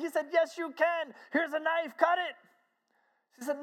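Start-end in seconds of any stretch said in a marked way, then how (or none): 0.3-2.4 high, excited coaxing; retold impatient urging. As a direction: push her to go ahead, pitched up. Harry urges her to cut cake.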